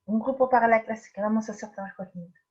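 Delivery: usual speaking voice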